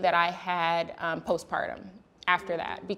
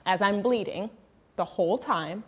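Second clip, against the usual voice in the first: normal talking voice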